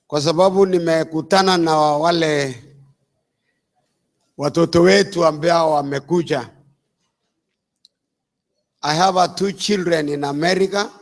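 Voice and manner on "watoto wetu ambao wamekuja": ordinary talk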